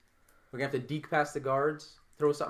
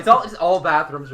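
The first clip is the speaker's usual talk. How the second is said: laughing; 3 semitones higher, 9 dB louder than usual. Real, not acted. Laughing at another bathroom.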